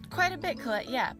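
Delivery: high loud eager reply; excited. Acted. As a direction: answer with a burst of cheer. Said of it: "replying to the puppet".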